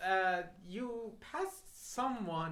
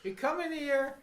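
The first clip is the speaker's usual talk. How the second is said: animated, higher and louder quote; Del Toro; 8 semitones higher, 8 dB louder than usual. Retold or imitated. Director hurrying an actor onto the set, animated. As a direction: call someone over with loud urgency. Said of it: "Del Toro was like".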